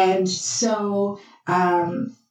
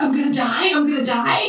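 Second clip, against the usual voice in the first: higher-pitched alarmed question; lightly acted rising panic; mock panic; 6 semitones higher, faster than usual. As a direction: retell her alarm at facing death. Retold shock at landmine.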